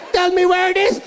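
mock mom yell. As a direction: demand it like his furious mother. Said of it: "Two hours of that".

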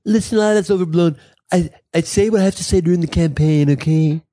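lightly voiced politician brushing off critics; candidate impression. Host as the candidate reassuring voters, light impression. Acts Trump promising to be presidential.

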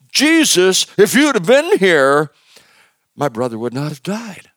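high, loud reproachful plea; reproachful cry. Retold or imitated. Sisters reproaching Jesus over their brother's death, high and loud. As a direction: accuse Jesus through grief. Mary and Martha blaming Jesus.

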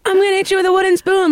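high put-on mom voice; imitating mom. Mom threatening daughters with wooden spoon, high mock voice. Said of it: "my mom, like, be like".

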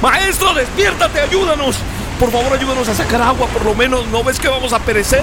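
high desperate begging; pleading panic. Acted. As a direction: beg the master to wake up. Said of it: "despiertan al maestro". (discípulos suplican despavoridos al maestro)

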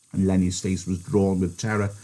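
ordinary delivery

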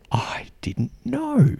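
slow mock whisper of amazement; hushed astonishment. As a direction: draw it out in a stunned half-whisper. Imitating the staring, stunned server.

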